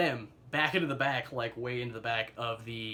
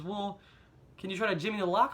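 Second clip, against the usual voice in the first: voicing mom; 7 semitones higher, 3 dB louder than usual. Mother voiced on phone.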